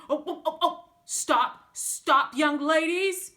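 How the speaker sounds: loud, high flustered cry of stop; a pastor calling to the running young ladies, loud and alarmed. A narrator's reading. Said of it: "he cried out".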